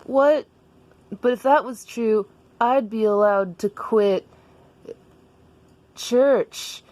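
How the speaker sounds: high, disbelieving character voice; Daniel. Acted. Daniel questions Jessica about quitting church, high and disbelieving. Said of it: "And Daniel was like".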